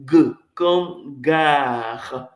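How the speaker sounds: "G comme gare" said very slowly, each sound spelt out; slow teacher's demo of "G comme gare"; slow model pronunciation. Shown as a demonstration. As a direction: draw out "G comme gare" for the class to repeat. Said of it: "Répétez après moi".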